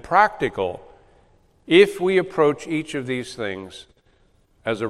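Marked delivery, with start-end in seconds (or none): none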